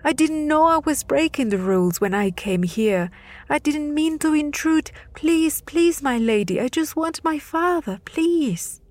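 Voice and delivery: high, begging cries; boy begs for his father; desperate pleading. Acted. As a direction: plead with everything left. Boy keeps begging the goddess.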